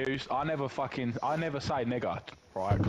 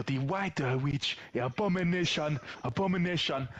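a hint of a scandalised auntie, higher; mock relatives; 4 semitones higher than usual. Auntie calling his mum abomination, lightly mocking. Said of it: "They go".

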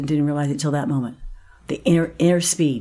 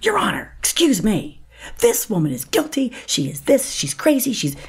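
indignant prosecutor; 7 semitones higher than usual. Retold or imitated. The prosecutor protesting to the judge, high and outraged. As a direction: play the prosecutor leaping up in protest. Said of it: "jumps up and goes".

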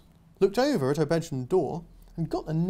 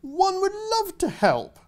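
much higher, slow, plummy knight voice; posh knight; 4 dB louder, slower than usual. Acted. Knight answering the girl, pompous and slow. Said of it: "the knight said".

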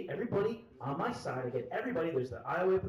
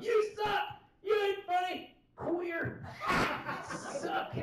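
high, yelled crowd jeers; hollering heckles in a pitched-up voice; mock heckling; 14 semitones higher than usual. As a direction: imitate a hostile drunk crowd hurling insults. Imitates hostile heckling crowd.

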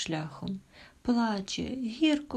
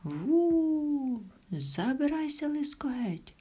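howling wolf; 8 semitones higher, slower than usual. Acted. Wolf howls at the fox to leave the hut, howling high. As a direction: howl it like a wolf driving off the fox. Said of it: "Вовк як завиє".

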